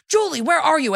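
lightly hammed-up bossy boyfriend yelling through the house; mock abusive boyfriend. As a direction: boyfriend shouting for Julie, a touch of mock yell. Abusive boyfriend calling for Julie.

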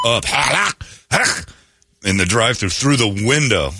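ordinary talk, nothing marked

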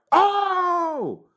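sudden high yelp, imitating a friend in sharp pain; mock pain yelp. Maul yelps from tooth pain.

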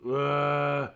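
loud, drawn-out "ugh"; big fed-up groan; exasperated groan. Acted. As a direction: groan loudly in mock agony. Exasperated at learning tech.

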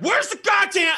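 high, loud frustrated demand; mock-furious outburst; acted exasperated yell. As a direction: yell it as a fed-up searcher. Acts out frantic search.

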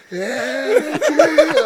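grito agudo e esticado, de gozação; berro do pai imitado. Retold or imitated. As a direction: imite o pai berrando, arrastando muito as vogais. Pai aponta o baixista e repete. (berro exagerado e prolongado)

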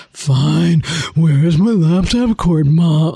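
low, drawn-out mock grumble; grudging kid impression. Kid grudgingly asking mom for help, mock sullen. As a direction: give in sullenly, dragging the words. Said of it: "often it's like".

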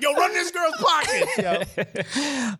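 joking street threat at the new girl, as a hammed-up shout; mock shout. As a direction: bark it like a playful street threat. Joking threat in a retold story.

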